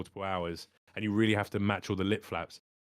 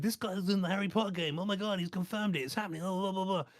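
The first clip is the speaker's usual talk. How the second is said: mock excited nerd; 9 semitones higher than usual. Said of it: "put it on a Reddit thread".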